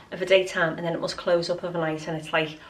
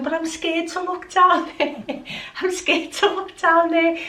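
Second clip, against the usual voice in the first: mock scared; 10 semitones higher, 4 dB louder than usual. Mock fear retelling husband's surprise.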